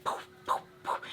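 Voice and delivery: soft puffs of breath; whispered poofs. Retold or imitated. Vlogger imitating a friend's vibes, breathy puffs. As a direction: puff out each one without voice.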